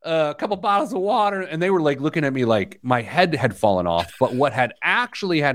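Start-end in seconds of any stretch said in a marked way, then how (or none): 0.0-1.4 raised-pitch retold order; higher, lightly mocking self-imitation; oblivious customer ordering. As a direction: play yourself breezily ordering at a stand. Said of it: "I was like".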